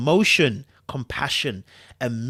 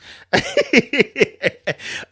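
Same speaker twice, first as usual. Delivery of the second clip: loud high laugh; high laughing fit; 11 semitones higher, 4 dB louder than usual. Real, not acted. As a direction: break into laughter. Laughs at his chat.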